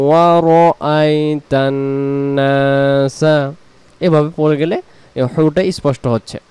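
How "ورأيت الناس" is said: slow, drawn-out chanted recitation; Quran recitation. Shown as a demonstration. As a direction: recite it slowly, stretching the vowels.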